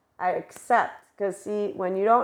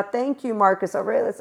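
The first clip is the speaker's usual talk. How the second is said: usual speaking voice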